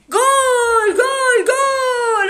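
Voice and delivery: high shouted goal call; screaming football commentator. Pretend. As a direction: yell the goal like a sportscaster. Calling the goal.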